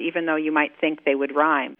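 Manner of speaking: ordinary talk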